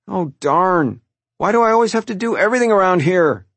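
high, loud, quickening complaint; petulant outburst, building to a wail; whining protest. A narrator's reading. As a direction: burst out at the unfairness. Child stomps off complaining.

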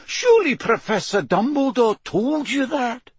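very high, protesting question; dismayed McGonagall. Acted. McGonagall asking Moody about Dumbledore, dismayed protest. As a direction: appeal to him in a high, dismayed voice.

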